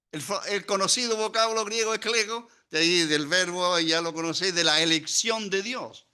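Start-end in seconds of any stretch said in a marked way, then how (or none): none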